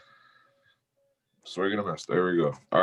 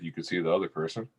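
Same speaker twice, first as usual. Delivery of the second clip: ordinary talk, nothing marked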